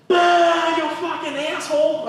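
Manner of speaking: imitating a fed-up crowd screaming at the comic; angry crowd yell. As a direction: bellow like a furious heckler. Crowd erupts at the punchline.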